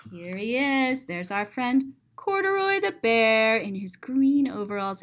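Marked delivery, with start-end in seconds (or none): none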